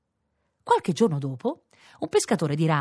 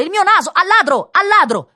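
high, very loud cries of thief; alarmed shouting; 10 dB louder than usual. A narrator's reading. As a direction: raise the alarm at full voice. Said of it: "gridò il signore". (grida acute di allarme)

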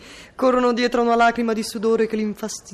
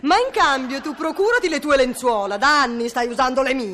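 quarrelling; 3 semitones higher than usual. Acted. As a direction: argue sharply with the spouse. Escalating domestic quarrel.

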